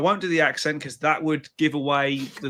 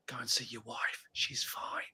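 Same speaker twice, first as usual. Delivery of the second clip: hushed brush-off; 5 semitones lower, 15 dB quieter than usual. Retold or imitated. DJ quietly sends the drunk groom back to his wife, whispering. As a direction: drop to a soft, breathy whisper.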